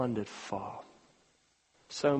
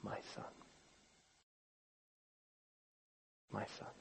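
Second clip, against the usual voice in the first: barely audible, tender father; tender hush; 6 semitones lower, 20 dB quieter, much faster than usual. Acted. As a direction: breathe it with love. Father answers angry son.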